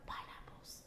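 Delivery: a flat, breathy whisper of Pineapples. Retold or imitated. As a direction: whisper it dead serious. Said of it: "he looked at me straight up".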